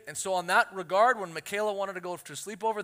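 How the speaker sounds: ordinary talk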